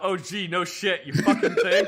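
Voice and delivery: retold mock-incredulous comeback, shooting up high; slow sarcasm bursting into a loud high yell; sarcastic outburst. Retold sarcastic comeback to squad leader.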